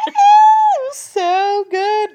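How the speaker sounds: very high, loud drawn-out gush; real shriek of delight; ecstatic squeal. Real, not acted. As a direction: squeal it out, stretching the words. Gushing over a favourite film moment.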